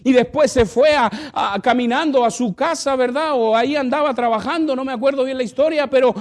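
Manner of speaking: loud, high preached storytelling; preaching at full force. Acted. Pastor retelling a testimony to the church, loud.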